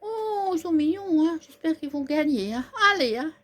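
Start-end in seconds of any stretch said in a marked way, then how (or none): none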